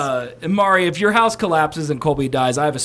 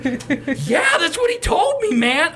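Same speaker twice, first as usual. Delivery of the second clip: excited high protest; 11 semitones higher, slower than usual. Retold or imitated. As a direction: pitch jumps high, insisting. Acted defensive reply to police.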